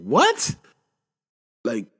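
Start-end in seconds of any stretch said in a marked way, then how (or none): 0.0-0.5 a loud, high burst of disbelief; shocked what. Retold or imitated. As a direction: told Kobe died in a crash, loud high shocked what. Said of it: "I'm like".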